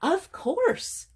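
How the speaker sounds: high-pitched storybook hare; Hare's voice. Acted. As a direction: pipe up high as a little animal character.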